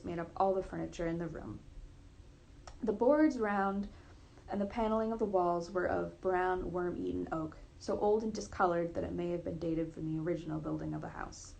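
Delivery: plain reading voice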